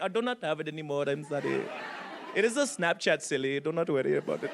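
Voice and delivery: low-pitched mock Indian accent; comic imitation of an Indian man's accent. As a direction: politely brush him off in an Indian accent. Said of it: "it was an Indian dude".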